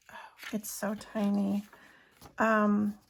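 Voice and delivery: ordinary talk, nothing marked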